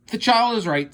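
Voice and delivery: high, loud declaration; emphatic teacher. A narrator's reading. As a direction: announce it to the class. Teacher monster agreeing.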